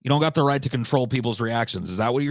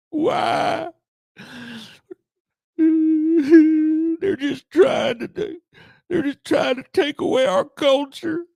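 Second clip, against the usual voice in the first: high, crying mock whine of a complaining conservative; mock whiny conservative; 14 semitones higher, 4 dB louder, slower than usual. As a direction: whimper it like a hammed-up crybaby. Mocking whiny Republican voice.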